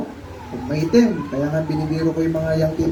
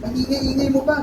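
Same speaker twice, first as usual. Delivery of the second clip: high, irritated put-down; acted annoyed bystander; scornful scolding; 7 semitones higher than usual. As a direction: scold a noisy beggar. Crowd scolding the blind beggar.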